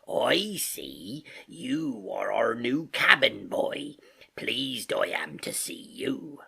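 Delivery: slow, drawling sailor's welcome; Long John Silver. Acted. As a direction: greet the boy in a husky seafarer's drawl. Silver greets new cabin boy.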